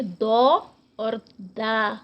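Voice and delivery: slow drawn-out دو then دا, the first full and rounded, the second open and thin; teacher sounds out دو and دا slowly; heavy do and light da. Shown as a demonstration. Quran teacher contrasting heavy دو and light دا for students. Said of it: "دو سے یہ different ہو جاتی ہے".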